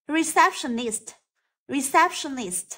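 Receptionist with stress on SEPT; stress on second syllable. Shown as a demonstration. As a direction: lean on the CEP in Receptionist. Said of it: "rơi vào từ sept". (nhấn âm "sept" trong Receptionist)

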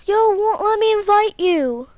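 high, loud complaint at the game; frustrated shout. Real, not acted. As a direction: yell it at the screen. Game refuses invite, frustrated.

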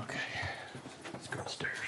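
low hushed whisper. Real, not acted. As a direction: keep it to a whisper. Sneaking upstairs quietly.

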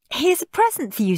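high, sweet goody-goody; high-pitched polite eagerness; eager little brother. A narrator's reading. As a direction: offer it like a perfect child. Perfect child gives Santa present.

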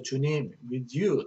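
ordinary talk, nothing marked

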